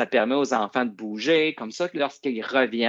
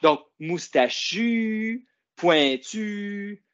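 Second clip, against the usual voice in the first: moustachu, pointu, very slow and higher, both final U drawn out; stress the rhyme; 8 semitones higher, much slower than usual. Shown as a demonstration. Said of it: "ça finit par le son U". (moustachu, pointu, très lents, le U final appuyé)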